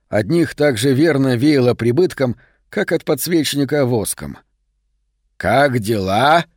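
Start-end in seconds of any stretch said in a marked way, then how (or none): 5.4-6.5 slow, drawn-out cheerful greeting; cheery greeting. A narrator's reading. Max greets the dealer from the doorway, cheery. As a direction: greet them breezily, stretching the words. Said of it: "бодро спросил с порога Мэкс".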